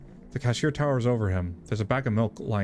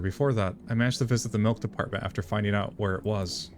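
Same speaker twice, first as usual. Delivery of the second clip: normal talking voice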